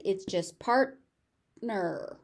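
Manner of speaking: drawn-out "partner" with a gentle, unpuffed T; unaspirated T. Shown as a demonstration. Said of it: "So that T is not aspirated".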